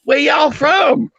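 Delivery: slow, high Southern drawl; Southern woman. Retold or imitated. Woman asks where the visitor is from, slow Southern drawl. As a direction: draw out the question like a Southern lady. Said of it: "she says".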